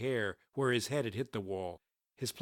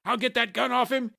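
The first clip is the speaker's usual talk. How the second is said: high, urgent bellow; 11 semitones higher, 4 dB louder than usual. A narrator's reading. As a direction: bellow it over the commotion. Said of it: "yet another bellowed".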